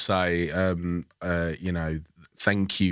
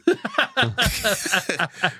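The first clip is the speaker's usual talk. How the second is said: high-pitched burst of laughter; high cackling laugh; 13 semitones higher than usual. Real, not acted. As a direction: let out a squealing cackle.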